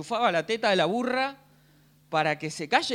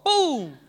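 louder, punchy mock smack; mock hit sound; 9 semitones higher, 6 dB louder than usual. Mother's teacher striking pupils, punchy mock smack. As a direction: slap the sound out like a pointer hit.

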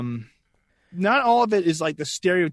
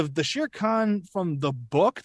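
usual speaking voice

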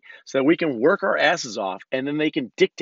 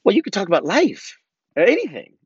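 higher-pitched voice imitating niece; imitated niece; 7 semitones higher, 4 dB louder than usual. Niece urging uncle to do his podcast, imitated high voice. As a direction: pitch it up as a niece pushing back. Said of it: "She's like".

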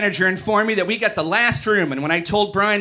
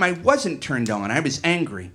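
ordinary delivery, nothing marked

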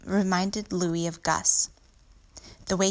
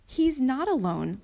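slow, higher-pitched objection; insistent, raised-pitch protest; 7 semitones higher, 3 dB louder than usual. A narrator's reading. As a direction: push back against the parents. Child protests to parents.

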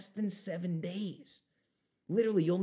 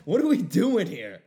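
exasperated shout; 2 semitones higher, 12 dB louder than usual. Real, not acted. Exasperated outburst at audience.

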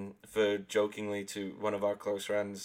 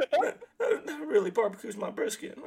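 laughing; 14 semitones higher than usual. Real, not acted. Laughing while repeating the phrase.

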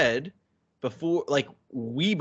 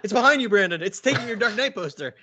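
panto crowd callback; 8 semitones higher, faster than usual. Pretend. Panto "it's behind you" bit.

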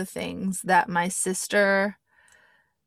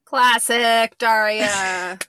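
very slow, louder tagline; drawn-out sign-off; 2 semitones higher, 5 dB louder, much slower than usual. Pretend. Co-host capping a Daria impression, drawn-out tagline. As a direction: stretch each word right out.

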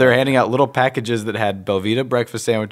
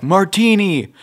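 high mock patron voice; 9 semitones higher, 4 dB louder, slower than usual. Crowd member voiced.

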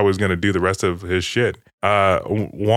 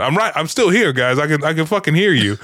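high-pitched mock reminder; imitated indignant interjection, pitched up; Penguin; 7 semitones higher than usual. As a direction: call out like an ignored captive. Retold Penguin calling out from behind.

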